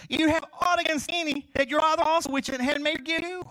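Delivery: loud, high preacher's reading of scripture, slowing at the end. Acted. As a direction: proclaim the verse to the congregation.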